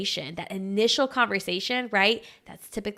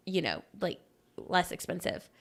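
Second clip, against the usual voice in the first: ordinary delivery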